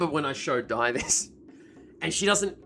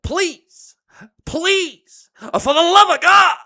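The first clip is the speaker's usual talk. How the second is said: high, loud, escalating plea; intense mock begging; 13 semitones higher, 11 dB louder, much slower than usual. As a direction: beg desperately, hammed-up, building to a shout. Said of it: "with that intensity".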